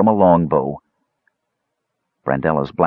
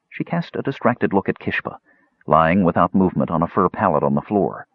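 plain reading voice

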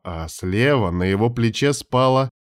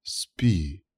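soft, hushed whisper; 3 semitones lower, 7 dB quieter than usual. Acted. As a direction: whisper it so she can sleep. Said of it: "шепнул он".

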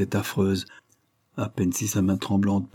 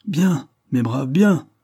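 high, slow hail, louder on the last word; amused exclamation; 6 semitones higher, 6 dB louder, much slower than usual. A narrator's reading. Delmare applauds his jumpy comrades, amused and exclaiming. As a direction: jovially call out approval, without a real laugh. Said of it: "s'écria-t-il en riant".

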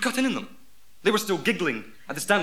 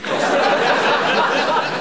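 drawn out punchline; 11 semitones higher, 12 dB louder, much slower than usual. Acted.

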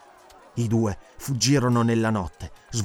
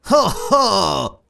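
higher, smug chuckling; chuckle, savouring the catch; mocking chuckle; 7 semitones higher, 4 dB louder than usual. Magistrate advancing on Conan, chuckling smugly. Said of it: "ridacchiò il magistrato".

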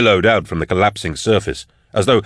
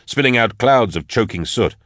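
plain reading voice

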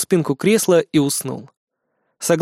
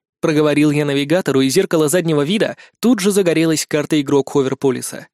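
ordinary delivery

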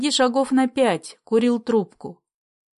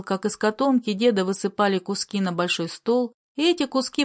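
ordinary delivery, nothing marked

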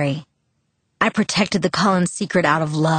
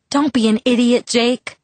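higher-pitched, exasperated complaint; complaining; 6 semitones higher, 3 dB louder than usual. A narrator's reading. Bella to Jake leaving by the window, higher exasperated complaint. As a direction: scold him, pitch up in exasperation. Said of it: "I complained".

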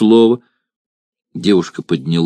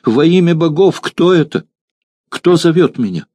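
higher, louder, frightened call into the dark; frightened loud question; 8 semitones higher than usual. Acted. Terrified girl calls out to the unseen voice, higher and louder. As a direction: cry out in terror to an unseen voice. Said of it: "она громко спросила".

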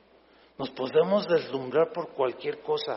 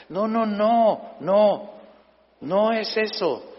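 slow emphatic no; 3 semitones higher, much slower than usual. Real, not acted. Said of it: "slow emphatic denial".

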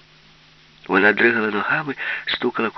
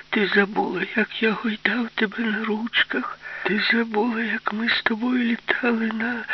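high, tearful whine of a fake father; whiny voice; 13 semitones higher than usual. Fat Man pleading with Alisa in a whiny voice. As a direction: whimper it like a pitiful daddy. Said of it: "плаксивим голосом".

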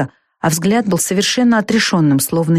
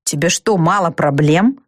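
ordinary delivery, nothing marked

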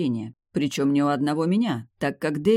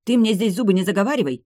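loud, fast, higher-pitched scolding; barked retort; 6 semitones higher, 6 dB louder, faster than usual. A narrator's reading. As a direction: fire it back, refusing to be fooled. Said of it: "рявкнула она".

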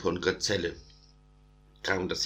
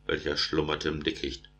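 plain reading voice

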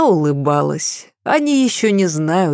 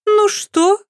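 high, mocking little question; ironic; 3 dB louder than usual. A narrator's reading. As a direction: ask it with teasing irony. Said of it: "иронично поинтересовалась Сесиль". (иронично)

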